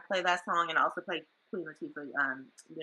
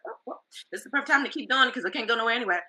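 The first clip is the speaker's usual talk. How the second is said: excited quote; 7 semitones higher, 7 dB louder, faster than usual. Retold or imitated. Excited quote of her past self.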